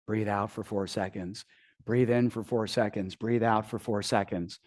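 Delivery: low, soft, steady breathing instructions; calm coaching. Retold or imitated. Coach guiding Brad's breathing in the ice bath, soft and calm. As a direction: talk him gently through each breath. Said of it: "she's just coaching me through it".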